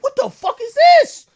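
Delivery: high, loud shocked yelp; high-pitched disbelief at a tank. Retold or imitated.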